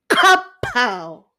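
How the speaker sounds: punchy imitated smack; spoken sound effect of a slap; swatting sound. A narrator's reading. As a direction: deliver it like a sudden swat. Swat answers the whining mosquito.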